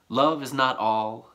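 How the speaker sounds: measured, deliberate sonnet reading; poem recital. Acted. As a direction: read each line as verse, pausing between. Reads the sonnet aloud.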